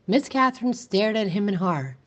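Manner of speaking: ordinary delivery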